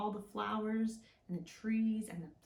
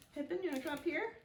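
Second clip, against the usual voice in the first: quick high coaxing call; higher, fast pet-talk voice; calling the cat; 6 semitones higher, much faster than usual. Real, not acted. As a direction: coax the cat up.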